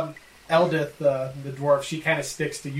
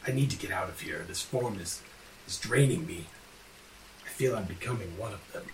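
softer, lower drained voice; weary, fading voice; 6 semitones lower, 6 dB quieter than usual. Acted. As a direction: sound the curse draining you. Prince weakened by curse.

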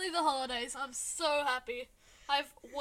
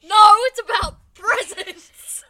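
loud laughing insistence; 7 semitones higher, 22 dB louder, slower than usual. Real, not acted.

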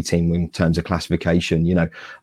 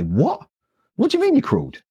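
much higher, stunned disbelief; incredulous. Retold or imitated. Friends back home ask about the climb, in high, stunned disbelief. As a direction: gasp it out like you can't believe it. Friends' astonished reaction, retold.

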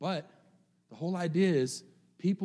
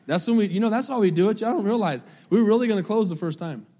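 higher, louder, playful; excited preaching; 6 semitones higher, 7 dB louder than usual. Acted. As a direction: pump the crowd up.

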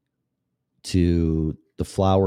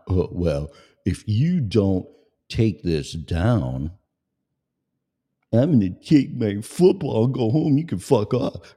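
mocking whiny musician; 3 semitones higher than usual. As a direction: whine it like a sulking kid.

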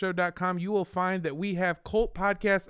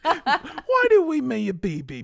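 loud, slow, higher, laughing British butler voice; Alfred the butler; 10 semitones higher, 6 dB louder, slower than usual. Pretend. Host mimicking a butler from Batman. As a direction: intone it like a grave old butler. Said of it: "Mister Wayne?".

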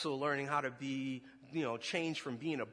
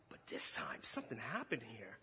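hushed confession; 4 semitones lower, 7 dB quieter than usual. Real, not acted. Preacher confessing his guilt to the congregation in a whisper. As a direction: drop to a whisper as the guilt hits.

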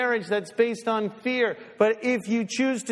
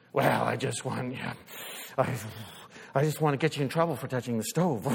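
lower, fast, sarcastic parent voice; lower, fast mock voice of a petty parent; mock spiteful parent; 6 semitones lower, 4 dB quieter than usual. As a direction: play the petty parent who wants the kid punished.

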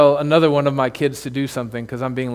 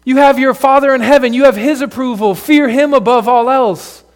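raised, louder, earnest plea from the future self; future self pleading; 6 semitones higher, 4 dB louder than usual. Acted. The you in heaven urging the you now, raised and intense. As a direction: call back to your younger self, urging hard. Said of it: "would come back and say to the you now".

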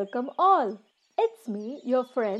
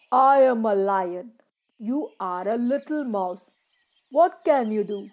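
mocking lion; 7 dB louder than usual. Lion scoffs at the tiny mouse, loud and mocking. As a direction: boom it out like a proud king.

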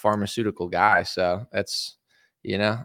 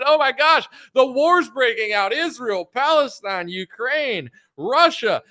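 much higher, hammed-up infomercial huckster; frantic gold huckster; 6 semitones higher than usual. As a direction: rant like a manic gold shill on TV. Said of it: "If I did that sales pitch".

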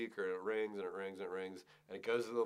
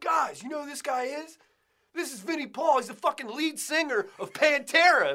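loud, high call to the bouncers; retold shout; 13 semitones higher, 9 dB louder than usual. To security guys about [name], a loud excited yell. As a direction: yell it across the club.

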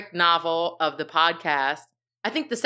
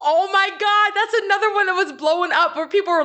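excited gasping; 11 semitones higher, 4 dB louder than usual. Real, not acted. Gasp at book title.

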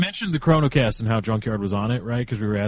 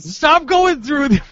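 mock scolding; 11 semitones higher, 4 dB louder, slower than usual.

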